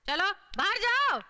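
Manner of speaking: firm order out. Acted. Ordering the boy out.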